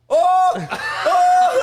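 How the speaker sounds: very high, loud laughing shout; excited shout. Retold or imitated. James shouting in joy at the riff. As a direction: yell it out, thrilled. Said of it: "and he went".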